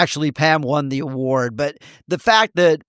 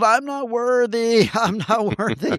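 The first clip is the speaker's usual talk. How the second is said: lightly acted, drawn-out worshipful chant; mock humility; 7 semitones higher than usual. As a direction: play at bowing down, higher in pitch. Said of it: "it's the old".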